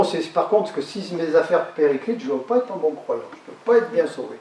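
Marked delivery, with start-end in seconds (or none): none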